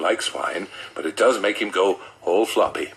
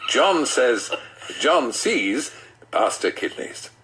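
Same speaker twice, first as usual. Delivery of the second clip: ordinary delivery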